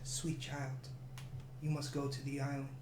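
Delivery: a quiet mystic oracle; hushed oracle. Acted. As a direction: speak like a gentle seer, hushed. Oracle speaks softly to player.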